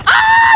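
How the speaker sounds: sudden high, loud shriek; startled scream. Real, not acted. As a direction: scream in shock at an ambush. Startled by an unseen enemy. (biglang tili sa gulat)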